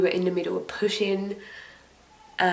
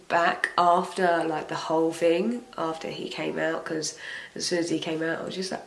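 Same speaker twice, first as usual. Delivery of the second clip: usual speaking voice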